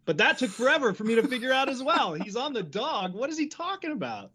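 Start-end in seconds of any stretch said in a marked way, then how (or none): 2.2-4.3 bewildered. Retold or imitated. Recalling own confusion.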